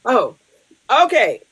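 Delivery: usual speaking voice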